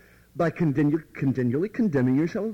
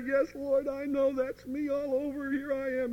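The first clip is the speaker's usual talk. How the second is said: higher, softer whimpering admission; self-pitying confession; 10 semitones higher, 5 dB quieter than usual. Retold or imitated. Believer confessing to the Lord in tears, understated sad whimper. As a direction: mope it out, a touch teary. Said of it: "we muddy the mud with our tears".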